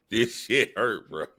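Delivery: ordinary talk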